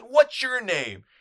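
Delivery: high, loud, drawn-out holler; imitated pushy photographer's shout; yelling. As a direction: yell it slowly to get attention. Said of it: "yelling at him and yelling at him".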